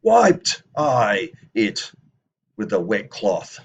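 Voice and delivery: very slow, loud, drawn-out declaration; wiped, very slow and loud; declarative reading. Shown as a demonstration. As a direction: proclaim each word slowly and loudly. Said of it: "I'm making a declaration".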